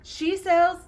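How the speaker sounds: high-pitched laughing attempt. Real, not acted. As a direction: try to start through real giggles. Tongue twister broken up by laughter.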